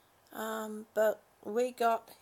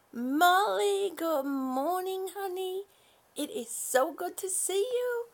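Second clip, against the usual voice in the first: sing-song greeting; 5 semitones higher, 4 dB louder than usual. Real, not acted.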